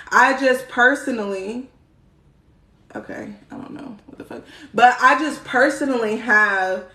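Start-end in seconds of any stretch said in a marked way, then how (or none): 3.0-4.4 breathy whisper, fast and low; quick whispered aside to herself. Real, not acted. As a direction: mutter it under your breath like an aside. Muttered aside, unvoiced.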